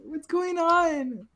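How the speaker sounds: put-on very high, drawn-out girly squeal; mock amazed girls. Mocking girls arriving at a takeover, high squealing amazement. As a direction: put on a slow, high-pitched girl voice. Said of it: "All the hot Cheeto girls".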